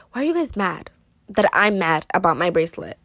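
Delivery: usual speaking voice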